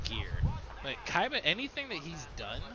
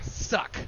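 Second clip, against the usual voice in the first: sharp unvoiced suck noise; voiceless throat suck like the parrot; parrot suck imitation; 5 dB louder than usual. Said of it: "come out of nowhere".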